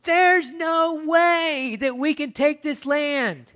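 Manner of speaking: high, slow, whiny spies' voice; mock defeatist whine; doubting spies. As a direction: drag it out like a fearful spy. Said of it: "and saying".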